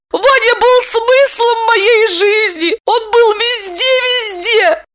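very high, drawn-out tearful wail; squeaky, crying woman; squeaking lament. A narrator's reading. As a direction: squeal it out in misery. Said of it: "пропищала Липочка".

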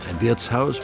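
ordinary delivery

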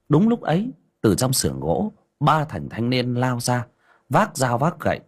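usual speaking voice

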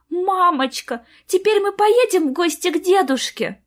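put-on high-pitched little girl's plea; pleading small voice; hopeful child. A narrator's reading. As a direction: ask mum sweetly and hopefully. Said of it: "с надеждой взглянула на маму".

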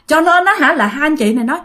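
plain reading voice

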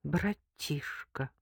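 slow, soft puzzling; hushed, dazed wondering; bewildered thought. A narrator's reading. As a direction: draw the word out, lost in thought. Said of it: "растерянно подумала Лика".

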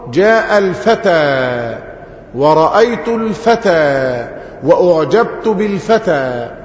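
each phrase said slowly to show الفتى stays the same; slow example sentences. Shown as a demonstration. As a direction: spell out الفتى slowly in all three cases. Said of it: "فأقول". (أمثلة تُنطق ببطء ووضوح)

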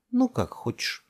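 put-on huffy, high-pitched child's retort; offended. A narrator's reading. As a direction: sound hurt and give up on them. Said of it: "обиделась Делия".

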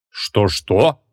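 mildly taken-aback question; understated surprise; astonished. Acted. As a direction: ask in disbelief. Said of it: "От удивления Креол даже перестал писать".

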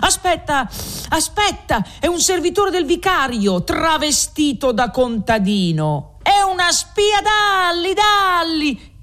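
high, urgent crowd-rousing cry; frenzied accuser. A narrator's reading. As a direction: whip the mob up against the spy. Rioter denounces Renzo as spy. (grida acute e concitate contro la spia)

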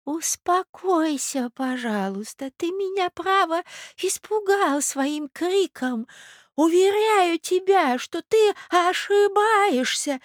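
very high, slow doddering voice; calm someone down, drawing out every word; old prince, placating. Acted. (старый князь, успокаивает высоко и протяжно)

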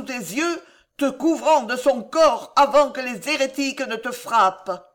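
high, loud dramatic lament; impassioned angry outcry; growling anger. A narrator's reading. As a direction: declaim it with bitter, rising force. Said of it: "gronda Catherine".

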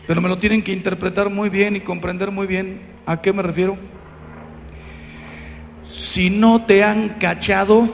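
normal talking voice

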